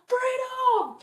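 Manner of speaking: long, high answering shout; crowd yelling back. Acted. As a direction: yell back the battle cry. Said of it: "they yell".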